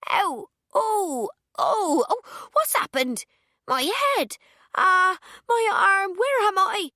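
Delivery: high, loud cries of pain and confusion; panicked pained cries. A narrator's reading. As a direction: yelp and babble, dazed after a fall. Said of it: "she gabbles on".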